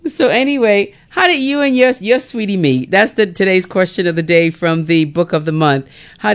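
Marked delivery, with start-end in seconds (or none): none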